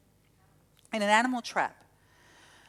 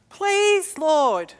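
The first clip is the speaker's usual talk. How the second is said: pleading prayer; 5 semitones higher, 4 dB louder than usual. Retold or imitated. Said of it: "I did".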